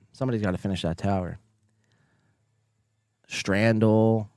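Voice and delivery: ordinary delivery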